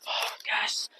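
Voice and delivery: whispered, pleased with herself; a soft, self-satisfied hush; smug whisper. Retold or imitated. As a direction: breathe it out as a satisfied secret. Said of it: "And I'm like".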